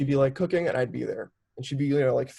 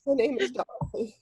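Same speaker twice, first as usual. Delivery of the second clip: put-on laughing, much higher grand Queen's English accent; 13 semitones higher, much slower than usual. Granddaughter imitates grandma's Queen's accent introduction, laughing. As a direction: announce yourself regally in a Queen's accent, giggling. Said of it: "does she not have a Queen's accent".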